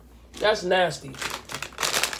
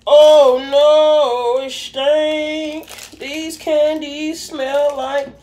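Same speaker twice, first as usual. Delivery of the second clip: disgusted; 7 semitones higher, 4 dB louder, much slower than usual. Real, not acted. Disgusted at candy smell.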